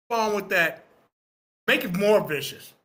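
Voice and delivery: normal talking voice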